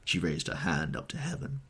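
plain reading voice

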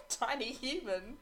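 laughing through the words; giggling as she says it. Real, not acted. Laughing while telling story.

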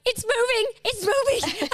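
high, loud mock squeal of alarm; imitated panicked rider. Daughter on a horse, mock panicked cry. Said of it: "She's like".

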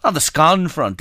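"scone" with a short open O, as in gone; scone rhyming with gone. Shown as a demonstration. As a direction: say "scone" to rhyme with on. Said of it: "if you want".

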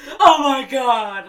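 loud, high, drawn-out panicked cry; freaking out. Retold or imitated. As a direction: stretch it out in alarm. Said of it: "freaking out".

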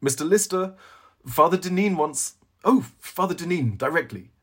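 high, loud call across the room; calling from the doorway. A narrator's reading. Attendant at the doorway calls the librarian, high and loud. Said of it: "An attendant from the doorway called".

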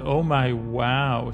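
ordinary delivery

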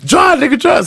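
high, urgent getaway yell; acted panicked order. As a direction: imitate frantic shouting at the driver. Acts out getaway order a second time.

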